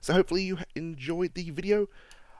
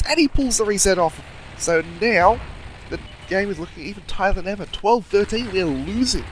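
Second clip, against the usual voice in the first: excited commentary; 6 semitones higher, 4 dB louder than usual. Real, not acted. Commentating a nail-biting finish to viewers, excited and higher. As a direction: pitch rising higher and louder as the match tightens. Tense final seconds of close match.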